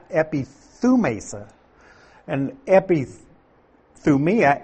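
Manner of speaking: epithumesa said slowly, eh-pee-thoo-MAY-sa; Greek word. Shown as a demonstration. Preacher sounding out the Greek word for his listeners. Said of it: "translation of the Greek phrase epithumia".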